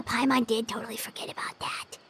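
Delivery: quick hushed whisper to herself; whispered aside. Acted. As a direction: breathe it out fast, like a secret.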